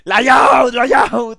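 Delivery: high panicked shout. Real, not acted. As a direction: yell it out in alarm. Shouting at a stream mistake.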